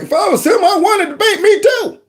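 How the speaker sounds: high laughing mock quote of Biden. Mocking the president's gaffe, high and laughing. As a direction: play him dopey and giggly, way up high.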